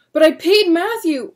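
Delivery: high loud desperate cry. Acted. William protesting to the deputy, desperate cry. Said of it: "William cried".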